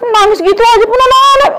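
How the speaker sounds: very high, loud shriek of protest; wife shrieking back at her husband; angry shout. Acted. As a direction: scream her defence at him.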